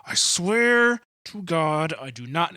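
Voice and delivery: slow, high drawn-out I swear to God; swearing an oath. Retold or imitated. Peter denying Jesus with an oath, in slow, high mock protest. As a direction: overplay the oath, stretching swear. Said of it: "he denied it with an oath".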